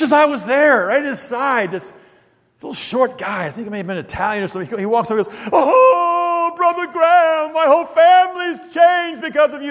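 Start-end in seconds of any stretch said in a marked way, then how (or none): 5.5-9.7 high, loud, hammed-up wailing gush; completely animated admirer. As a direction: imitate an overwhelmed fan nearly crying. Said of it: "he's just really getting completely animated".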